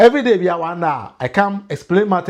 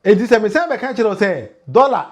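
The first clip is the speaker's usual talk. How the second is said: normal talking voice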